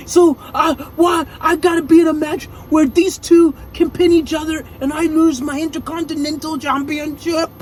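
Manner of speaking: high, loud mock-Austrian shout; Arnold Schwarzenegger shouting. Furious champion yelling at Pierce, mock Austrian shout. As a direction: yell it like an angry action hero. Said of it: "sounding like Arnold Schwarzenegger".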